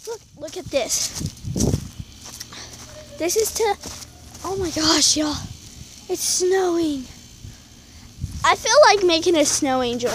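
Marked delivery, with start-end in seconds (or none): none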